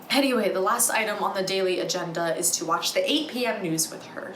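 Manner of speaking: ordinary talk, nothing marked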